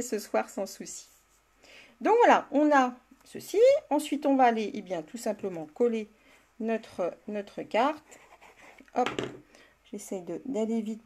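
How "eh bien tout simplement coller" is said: ordinary delivery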